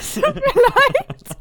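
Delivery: high, slow, laughing through words; laughing apology. Real, not acted. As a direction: apologize while cracking up. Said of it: "hörst du auf zu husten".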